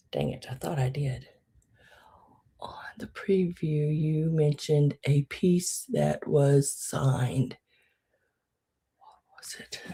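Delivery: hushed whisper reading to herself. Real, not acted. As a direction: mutter it under your breath. Reading comment to self.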